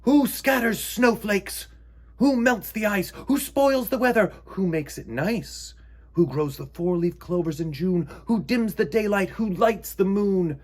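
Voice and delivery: high, loud, theatrical poem recital; recited from a stage. Acted. Frederick reciting his poem to the mice, theatrical and loud. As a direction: declaim it like a poet on stage. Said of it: "as if from a stage".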